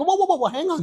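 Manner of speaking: high-pitched rush to halt someone; urgent interruption. Retold or imitated. Customer stops clerk ending the call, high urgent interjection. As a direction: cut in high, stopping the caller short.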